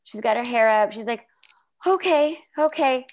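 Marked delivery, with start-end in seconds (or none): 1.9-3.1 high, anxious little okays; nervously bracing. Retold or imitated. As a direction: imitate someone nervously bracing for bad news. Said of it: "Rachel's starting to sweat bullets".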